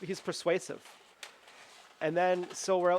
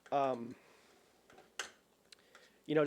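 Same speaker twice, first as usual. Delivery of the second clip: ordinary talk, nothing marked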